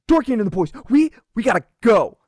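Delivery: high, loud stammering panic; panicked retreat. Acted. Bully calling his boys to run, high panicked shout. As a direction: urge the boys to flee, flustered. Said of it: "he's like".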